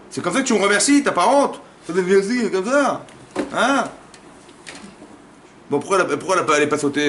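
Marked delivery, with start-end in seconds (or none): 0.1-3.8 high, raised-voice reproach; indignant scolding. Acted. Husband rebukes wife over her thanks, indignant scolding. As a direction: scold with rising, affronted questions.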